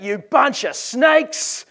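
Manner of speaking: high, drawn-out accusing yell; John the Baptist yelling. Acted. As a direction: bellow the insult slowly across the room. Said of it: "and John yells out".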